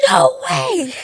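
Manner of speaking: very high squeal of disbelief; excited screaming. Retold or imitated. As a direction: squeal it, reliving the thrill. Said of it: "we were screaming".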